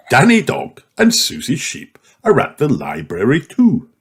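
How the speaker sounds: plain reading voice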